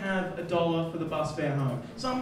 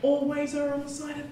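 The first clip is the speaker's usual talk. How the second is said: ordinary talk, nothing marked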